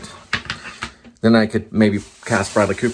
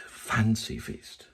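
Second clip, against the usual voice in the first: softer, very slow whisper of the brand; soft ad tagline; 9 dB quieter, much slower than usual. Pretend. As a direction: breathe the name like a secret. Trying takes of tagline.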